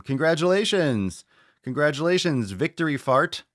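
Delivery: high, loud congratulations; mock-triumphant shout-out; celebratory announcement. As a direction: call it out like a game-show win. Celebrates the winner.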